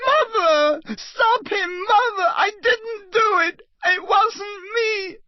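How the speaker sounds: high sobbing begging; weeping desperate denial; crying plea. Acted. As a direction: cry out to your mother for help. Boy begging while sentenced.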